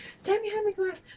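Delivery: very high-pitched mocking imitation; annoying woman. Cashier ordering customer behind the glass, mock annoying nag. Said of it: "really annoying woman".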